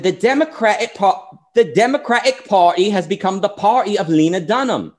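a British commentator's accent, mildly imitated; Milo's British accent. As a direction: put on a posh-leaning British accent for the quote. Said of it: "the accent sounds more like Sargon than Milo".

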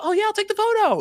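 mocking his own eager offer; mock eager self. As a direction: chirp it helpfully. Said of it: "I was like".

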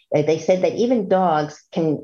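usual speaking voice

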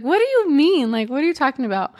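high-pitched imitation of a puzzled reply; quick, high, mock bewilderment; baffled. Clinic girl to patient asking about the ultrasound, baffled.

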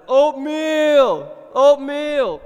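put-on high loud child's cry; little daughter waking the house for oatmeal; toddler yelling. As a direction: shout it like a small child at dawn. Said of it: "waking up to the sound of".

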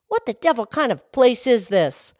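loud, high, indignant snap; snapped. Acted. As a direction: bark it at the manager like a cheated customer. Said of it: "Falsehood snapped".

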